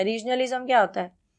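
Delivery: ordinary delivery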